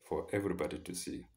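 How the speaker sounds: ordinary delivery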